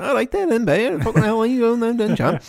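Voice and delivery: very high squeaky Manx impression; hammed-up high Manx accent; uncle's high-pitched Manx. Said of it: "my uncle's got the fucking up there".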